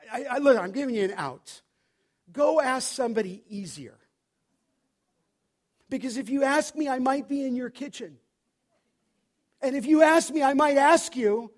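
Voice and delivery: building to a loud, high challenge; forceful warning. Retold or imitated. Pastor acts out warning church member.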